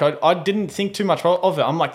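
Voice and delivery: ordinary delivery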